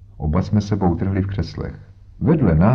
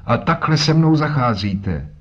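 put-on indignant old man; 8 semitones higher, 3 dB louder than usual. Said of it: "zvolal a třískl holí".

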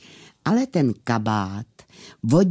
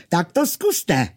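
amused, higher dare; laughing; 6 semitones higher, slower than usual. Acted. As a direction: say it just after a chuckle. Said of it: "zasmál se mlynář".

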